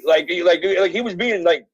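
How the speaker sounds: ordinary delivery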